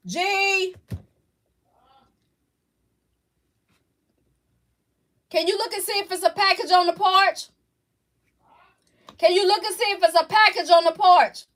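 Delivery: loud and high, calling out to another room. Real, not acted. Asks someone in another room to check the porch for a package, calling out loud. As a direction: call out to someone in the back of the house.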